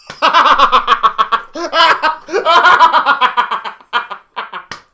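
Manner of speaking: a helpless, high laughing fit; loud laughter. Real, not acted. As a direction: burst into long, loud laughter. Cracks up at the Latin name.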